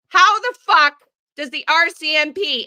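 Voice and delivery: real outraged shout; angry outburst. Real, not acted. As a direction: explode with outrage.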